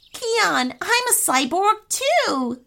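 high-pitched gleeful exclamation; delighted. A narrator's reading. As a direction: say it with delighted wonder, pitched well up. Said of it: "laughed Rami delightedly".

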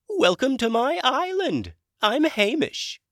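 high, loud dragon character voice; Hamish dragon voice. Acted. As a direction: play a chirpy, high-pitched dragon wizard. Dragon introduces himself.

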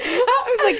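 high laughing voice; giggling while telling it. Real, not acted. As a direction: laugh through the words.

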